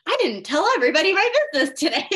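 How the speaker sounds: high, loud and fast, tumbling out; a hammed-up rushed quote; mock rushed confession. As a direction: blurt it like a mock oversharer. Mocking friend's rushed confession.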